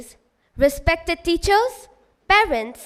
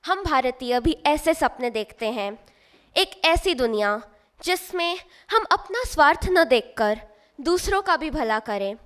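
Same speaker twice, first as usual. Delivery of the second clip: ordinary delivery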